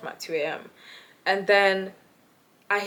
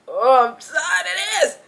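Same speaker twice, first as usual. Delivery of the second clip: fed up; 7 semitones higher, 5 dB louder, slower than usual. Retold or imitated. Thinking in own head, fed up.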